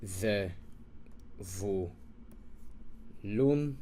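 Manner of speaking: Ze, vu, lun each set apart by pauses; syllable by syllable. Shown as a demonstration. Teacher breaking Zevulun into syllables, slow. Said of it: "This is ze".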